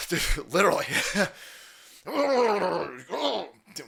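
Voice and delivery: ordinary delivery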